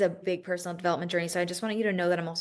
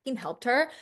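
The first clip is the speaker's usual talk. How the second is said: mild frustration; 6 semitones higher than usual. Retold or imitated. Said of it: "you get so frustrated".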